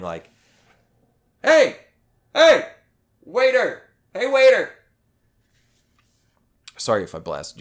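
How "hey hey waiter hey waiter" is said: loud, high yelling across a restaurant; imitated yelling. Imitating a guy yelling for a waiter across the room, loud holler. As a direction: holler for the waiter at full volume. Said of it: "the guy was just yelling".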